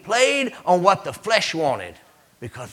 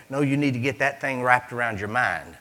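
usual speaking voice

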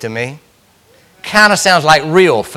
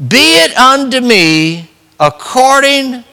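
drawn-out reverent; 7 semitones higher, slower than usual. Acted. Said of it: "Behold, the handmaiden of the Lord".

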